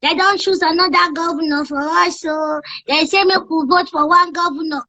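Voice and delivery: put-on high childlike chatter, light and bouncy; baby voice. Radio host doing a high child voice for listeners. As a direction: talk like a cheeky little kid on air. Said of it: "just use that baby voice".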